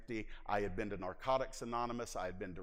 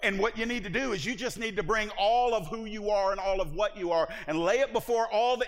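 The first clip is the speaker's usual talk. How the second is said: loud, high, driving preacher's call; full-force preaching; 11 semitones higher, 10 dB louder than usual. Acted. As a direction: preach it to the back row.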